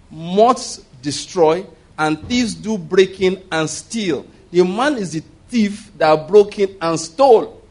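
drawn out, each word weighed; slow it right down to land the verse; slow scripture emphasis. Real, not acted. Preacher slowing to drive the verse home to the congregation.

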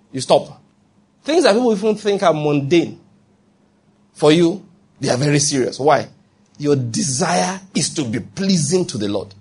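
ordinary delivery

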